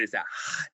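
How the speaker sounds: "hot" pushed out as a voiceless, airy whisper; breathy whispered hot. Shown as a demonstration. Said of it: "put your hand in front of your mouth and say the word hot".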